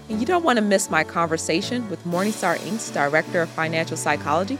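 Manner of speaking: podcast outro read. Acted. Host outro read over music.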